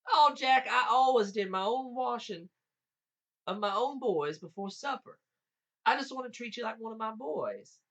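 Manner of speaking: high-pitched, insistent motherly wheedling; coaxing giant lady. A narrator's reading. Giant lady persuading Jack to be washed, coaxing.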